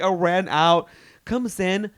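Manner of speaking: ordinary delivery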